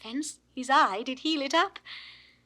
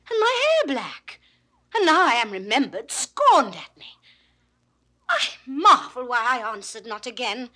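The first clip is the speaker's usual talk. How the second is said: indignant; 8 dB louder than usual. Acted. Phoebe to Silvius about being chided, indignant. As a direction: protest with wounded pride, loud.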